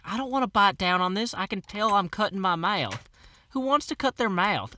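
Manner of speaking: high, loud, put-upon whine; whiny complaint. Retold or imitated. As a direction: protest like a fed-up patient. Complaining about the dentist's bite plate.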